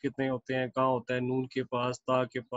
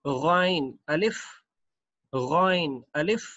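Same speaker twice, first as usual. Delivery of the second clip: slow reading; slower than usual. Shown as a demonstration. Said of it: "next पढ़ते हैं again अलिफ".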